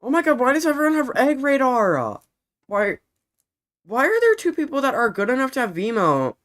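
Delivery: loud, high, disbelieving complaint; high, loud, frustrated outburst at other players; exasperated rant. Real, not acted. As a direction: vent at everyone's luck out loud.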